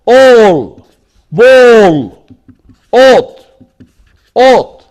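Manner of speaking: "All", "ball", "ought" with a long rounded aw; long O vowel. Shown as a demonstration. Teacher demonstrating the O, long vowel in "All", "ball", "ought". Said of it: "What about the O?".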